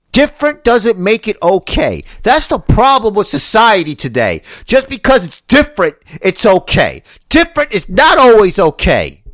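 high, insistent tirade; indignant rant. Real, not acted. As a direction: hammer each point in exasperation. Indignant rant about society.

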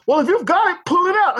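high-pitched mock dare from a cocky neighbor; retold challenge. Neighbor daring the driver to show the gun, mock challenge. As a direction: imitate a man calling someone's bluff.

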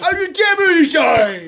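shouted, high mock British; shitty British accent. Co-host echoing the presenter in disbelief, shouted fake British. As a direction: holler in bad British. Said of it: "activate shitty British accents right now".

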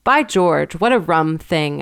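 usual speaking voice

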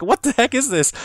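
high-pitched mock disbelief; incredulous. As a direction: imitate a friend's baffled protest. Retold friend disbelieving the game's accent.

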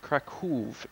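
Kraków said the Polish way, ending in an oov sound; Kraków with an oo, not an oh; Polish pronunciation. Shown as a demonstration. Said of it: "with a Polish accent".

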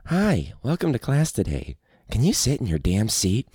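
a tired talking teddy bear scolding the class; jaded teacher as a talking teddy bear; jaded Teddy Ruxpin. Retold or imitated. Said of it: "jaded Teddy Ruxpin".

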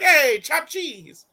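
high, slow mock greeting; bodega buddy. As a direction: stretch it out like a corny local. Mocking human's bodega greeting.

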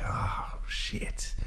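hushed, sinking aside; dismayed whisper. Retold or imitated. Suspect hearing narcotics cops are coming, whispered mock dismay. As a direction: whisper it as if the trouble just landed. Retold dismay at police news.

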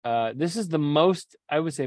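ordinary delivery

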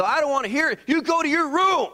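high, loud mock angry order; angry dad. Father ordering his son to his room, loud mock anger. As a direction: bark the order at the kid. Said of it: "I, I go".

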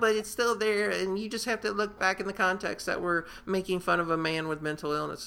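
high-pitched imitation of someone making excuses; mock objection. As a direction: argue back in a put-on high voice. Acts out YouTubers' objection in quote.

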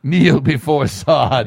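slow, booming villain command; booming echoing villain monologue. Acted. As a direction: command it like a tyrant. Zod quote performed.

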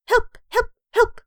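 high, loud little yelps; comic cries for help. A narrator's reading. Narrator voicing the frightened girl, squeaky yelps. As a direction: yelp each word short and high. Girl's cries acted in story.

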